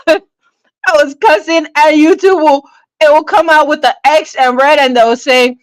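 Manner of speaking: ordinary talk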